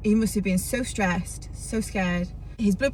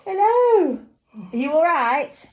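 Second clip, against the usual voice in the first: loud high call to a deaf man; shouting; 7 semitones higher, 6 dB louder than usual. Real, not acted. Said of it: "I'm gonna have to shout".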